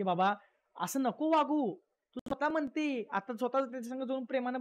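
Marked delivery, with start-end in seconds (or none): none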